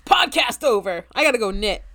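loud mock announcement; mock sign-off. Joking to co-host that the show is done, loud mock sign-off. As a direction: declare it loudly, as a joke.

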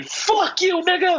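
high, held-back retold yell; imitated scream. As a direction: mimic a movie scream up high, holding back volume. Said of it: "and [name] screaming".

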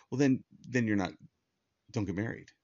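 ordinary talk

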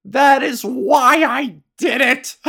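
imitated celebratory yell. Host quoting a bowler after bowling, mock triumphant yell. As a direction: bellow it as a slow victory cry. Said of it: "he yelled".

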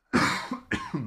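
sudden choking cough; coughing fit from a pistachio. Real, not acted. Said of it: "je m'étouffe avec une pistache".

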